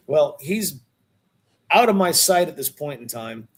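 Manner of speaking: ordinary delivery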